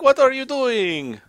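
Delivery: high, loud and slow mock yell; mock scream. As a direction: imitate him screaming, stretched out. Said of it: "you are screaming my name".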